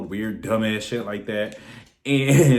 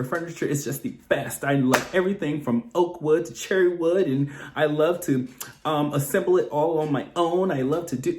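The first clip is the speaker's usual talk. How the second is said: higher, overly polite interview voice; mock the eager applicant, sweet and prim; proper job-interview voice; 5 semitones higher than usual. Shown as a demonstration. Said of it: "we have to go in there and speak like this".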